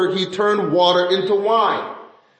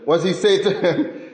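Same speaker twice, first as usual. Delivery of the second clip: ordinary delivery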